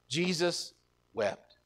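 very soft and drawn out; solemn sorrow. A narrator's reading. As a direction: let each word fall slowly and quietly. Preacher solemnly recounts Jesus's sorrow.

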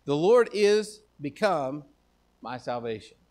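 preacher restoring the exact text; slow emphatic reading. Shown as a demonstration. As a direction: insist on the original wording slowly. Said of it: "That's not what it says".

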